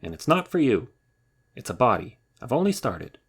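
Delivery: loud, high, indignant; furious. Acted. Rabbit furious at Bear over his snowball, loud. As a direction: spit it out, offended. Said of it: "said Rabbit, furious".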